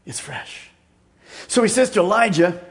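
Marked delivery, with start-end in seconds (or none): 0.1-0.7 whispered. Shown as a demonstration. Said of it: "preacher whispering repeated phrase".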